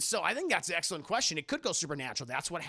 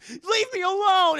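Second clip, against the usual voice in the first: high, very loud, drawn-out victim yell; hammed-up slow scream of protest; mock scream; 13 semitones higher, 12 dB louder, slower than usual. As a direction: cry out like a horror heroine. Retold victim yelling at killer.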